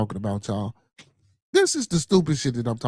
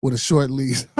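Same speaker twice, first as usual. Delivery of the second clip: ordinary talk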